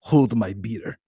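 lightly voiced Russian leader; a hint of a mock strongman; Putin. Host playing the leader next door, lightly voiced impression. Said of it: "I'm Putin".

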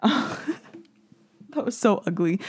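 laughing through the words; slow, laughing, embarrassed. Real, not acted. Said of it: "I am so embarrassed".

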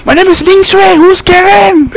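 high, loud mock-indignant voice; hammed-up high indignation; indignant insulted woman. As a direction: play an offended woman protesting in a high voice. Acts out the insulted woman replying.

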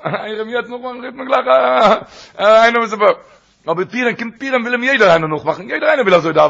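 high and loud, laughing through it; excited retelling. Retold or imitated. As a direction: tell it with rising glee.

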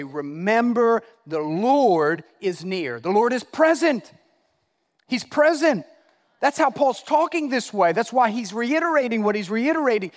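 impassioned pulpit proclamation; full-force preaching. Real, not acted. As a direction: proclaim it, rising to a shout.

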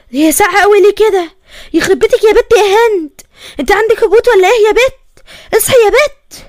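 increasingly high, worried urging; rising alarm. Acted. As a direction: urge her awake as worry climbs. Alarmed, girl is cold and limp. (قلق متصاعد وهي بتصحيها)

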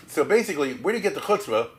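ordinary talk

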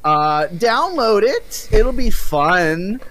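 high, slow mock celebrity guest; Hideo Kojima. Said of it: "uh, Hideo Kojima".

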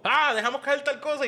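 high, feigned casual excuse; mock innocent excuse. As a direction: pretend you dropped something, sing-song. Actúa excusa al prender luz. (excusa fingida con voz aguda)

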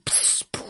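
soft voiceless puff of escaping air; deflating ball noise. A narrator's reading. Alien stomps the boy's football, hissing puff.

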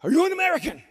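loud, high eager shout; excited shouted question. Retold or imitated. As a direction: call out eagerly to a stranger. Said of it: "they go".

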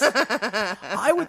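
ordinary talk

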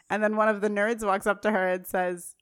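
ordinary delivery

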